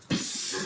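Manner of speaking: whispered psst of a dough toss; a breathy toss sound; whoosh sound effect. Retold or imitated. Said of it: "be in the back there like".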